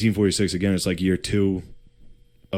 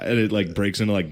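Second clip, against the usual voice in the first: ordinary talk, nothing marked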